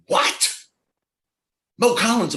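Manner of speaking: high, loud shocked disbelief; squealing surprise at a famous name; sister's shock. Retold or imitated. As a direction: act out the kid sister's jaw drop. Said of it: "my sister ... goes".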